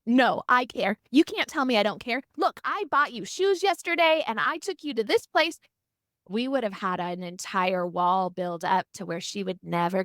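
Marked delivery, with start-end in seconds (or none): 0.0-5.6 defensive mom. Retold or imitated. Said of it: "defense of like".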